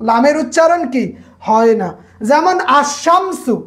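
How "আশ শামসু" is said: আশামসু said slowly, the lam dropped and the শ doubled; sun letter pronunciation. Shown as a demonstration. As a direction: leave out the লাম and lean on the doubled শ in আশামসু. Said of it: "এই শিনের উপর তাসদিদ আইছে". (ধীরে আশামসু, লাম বাদ দিয়ে শ দ্বিগুণ)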